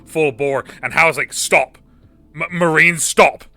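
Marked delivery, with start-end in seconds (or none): 1.3-3.4 raised, loud imitation of a command; mock military order. Howard ordering a Marine to stop, loud imitated command. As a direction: call it out like a sergeant halting a recruit.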